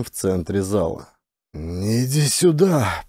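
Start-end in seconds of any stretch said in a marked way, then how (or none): 1.5-3.0 growled. A narrator's reading. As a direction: growl it out slowly, menacing. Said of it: "прорычал майор".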